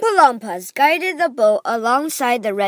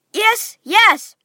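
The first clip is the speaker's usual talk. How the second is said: higher, louder eager agreement; eager cry; 9 semitones higher, 5 dB louder than usual. Acted. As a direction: promise it eagerly together. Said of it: "the children cried".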